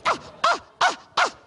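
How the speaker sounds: very high rhythmic "ah" squeals; short mock squeals on the beat; excited yelps. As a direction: yelp along to a dance workout. Imitates himself dancing wildly.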